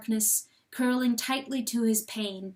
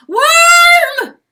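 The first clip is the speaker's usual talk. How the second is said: much higher, much louder held scream; 20 dB louder than usual. A narrator's reading. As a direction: scream from the top of the lungs. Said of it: "screamed from the top of his lungs".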